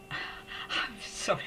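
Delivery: slow, breathy-edged apology with a sigh, close to tears; the feeling catches up with her mid-story; getting emotional. Real, not acted. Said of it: "I'm getting a bit emotional".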